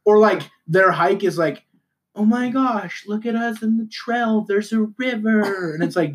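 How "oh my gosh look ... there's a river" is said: high, put-on gushing; mock gushing hiker. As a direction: parody a thrilled would-be hiker. Mocking fake hikers.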